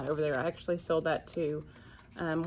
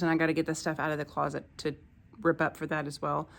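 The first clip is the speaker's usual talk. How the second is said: usual speaking voice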